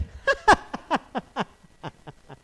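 high loud mocking laugh. Dad laughing at his son on the phone, high loud cackle.

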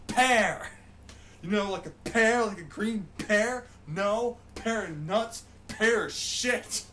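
loud, high ranting correction; exasperated shouting. Real, not acted. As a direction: hammer the word pear, fed up. Loudly spelling out the right word.

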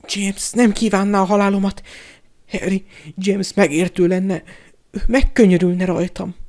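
high, wheedling voice; cringing, desperate appeal; pleading coward. A narrator's reading. Pettigrew begs for his life.

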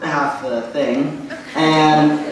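ordinary delivery

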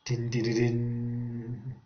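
low, soft dun dun dun; suspense sound. A narrator's reading. Narrator building suspense before the waterfall drop. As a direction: hum a dark suspense beat.

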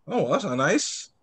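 ordinary delivery, nothing marked

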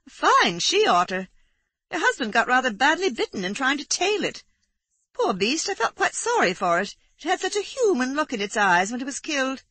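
hearty country neighbour back from the hunt; Aurora hearty. A narrator's reading. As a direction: chat cheerfully about the kill. Narrator voices hearty neighbour Aurora.

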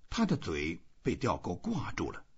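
ordinary delivery

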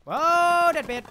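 sudden loud, high cry of alarm; startled yell. Real, not acted. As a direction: burst out yelling at the sudden enemy. Startled by the enemy appearing.

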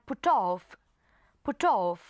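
"put off" run together as "pu-toff"; put off linked. Shown as a demonstration. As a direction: carry the T of "put" onto "off". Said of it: "T กับ O อีกเหมือนกัน". (อ่าน "put off" ลากเสียง T ไปหา off)

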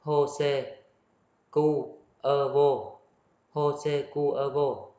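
Jose Cuervo in Spanish, "ho-se kuer-vo", drawn out slowly; slow, careful "ho-se kuer-vo" at the end; Spanish pronunciation. Shown as a demonstration. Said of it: "này là tiếng Tây Ban Nha".

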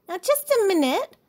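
high, loud interruption in protest; protesting little cub; indignant protest. A narrator's reading. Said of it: "protested Sister".

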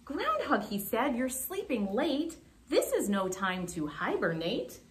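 lively, measured storytime narration; unhurried, sing-song picture-book reader; children's story reading. A narrator's reading. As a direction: read to young children with big pitch swings.

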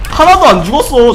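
loud, high exasperated cry; frustrated yell. Real, not acted. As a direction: yell it in disbelief. Frustrated gamer outburst.

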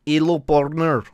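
goofy Russian cowboy accent. Shown as a demonstration. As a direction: blend Russian vowels into a cowboy greeting. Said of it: "Cowboy Russian?".

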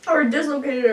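speaking through fingers; muffled behind hand; hand over mouth. Real, not acted. Seen 0: Left speaker keeps her hand pressed over her mouth throughout; laughing unclear.

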